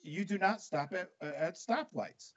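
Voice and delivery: restrained, high-pitched retold yell; screaming cop, retold. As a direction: imitate a cop laying down the law. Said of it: "he starts screaming at us".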